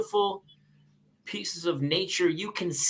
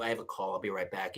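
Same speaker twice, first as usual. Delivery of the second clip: much softer, apologetic low aside; polite hush; 9 semitones lower, 10 dB quieter than usual. Retold or imitated. Polite old phone etiquette.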